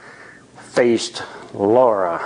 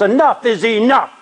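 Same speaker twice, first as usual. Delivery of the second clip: higher, angry yell into the night; 9 semitones higher than usual. A narrator's reading. Johnny yelling at the pranksters outside the car, angry yell. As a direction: drive them off with a shout. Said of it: "Johnny yells".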